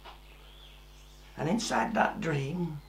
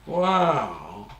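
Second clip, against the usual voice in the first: usual speaking voice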